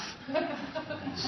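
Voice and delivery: high burst of laughter. Real, not acted. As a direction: break into laughter. Laughing at own story.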